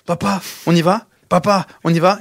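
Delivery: put-on insistent kid tugging for attention; imitated impatient child. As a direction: nag Dad to get going. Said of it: "t'as ton enfant qui te tire le pantalon".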